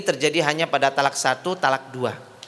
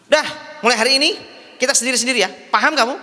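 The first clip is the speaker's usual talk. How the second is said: higher, brisk, stern husband's order; 8 semitones higher, 4 dB louder, much faster than usual. Acted. Husband telling wife they go separate ways, stern. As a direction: lay down the law, voice raised in pitch.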